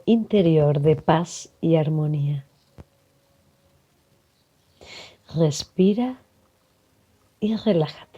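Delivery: calm meditation voice with long pauses; guided meditation. Acted. Facilitator guiding listeners to breathe and relax, calm meditation delivery. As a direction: guide them gently into relaxation, leaving space to breathe.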